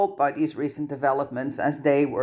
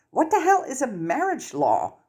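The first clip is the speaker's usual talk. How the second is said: baffled; 10 semitones higher than usual. Acted. Ron baffled by the news.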